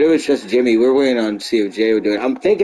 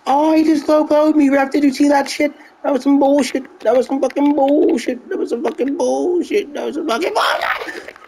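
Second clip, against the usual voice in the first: very high squealing complaint; mock-indignant, high-pitched ranting; outraged protest; 12 semitones higher than usual. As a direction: cry foul to the ref, hammed-up. Protesting a foul to the ref.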